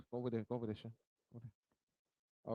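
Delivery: softly telling Sean to move over; quiet aside. Real, not acted. Speaker quietly tells Sean to go over there, hushed. Aside to a child mid-lecture.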